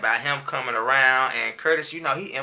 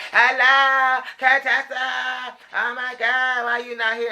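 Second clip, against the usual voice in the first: high, loud, drawn-out sing-song hello; mock Toya gushing down the phone, much higher; over-sweet phony hello to a rival; fake-cheery phone greeting; 12 semitones higher, 6 dB louder, slower than usual. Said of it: "'cause she was like".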